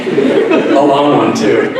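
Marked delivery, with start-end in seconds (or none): none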